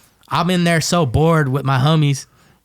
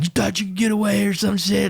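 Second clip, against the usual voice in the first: higher, mocking taunt; taunting captor; 7 semitones higher than usual. Guy who caught him taunting, higher mock sneer. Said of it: "he's like".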